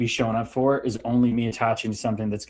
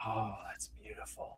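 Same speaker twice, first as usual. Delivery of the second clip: hushed breathy aside to himself; whisper; 4 semitones lower, 21 dB quieter than usual. Retold or imitated. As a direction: whisper it like a private thought. Inner voice quoted mid-story.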